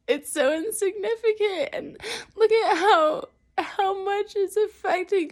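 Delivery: high, loud teary voice; words pushed out through real tears; breaking down over something small; crying. Real, not acted. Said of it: "this is where I'm, like, struggling".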